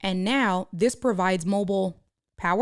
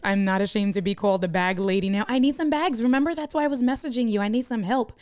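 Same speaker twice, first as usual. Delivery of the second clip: usual speaking voice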